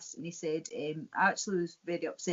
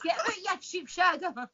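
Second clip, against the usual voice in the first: laughing mock insult; 11 semitones higher than usual. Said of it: "They're like".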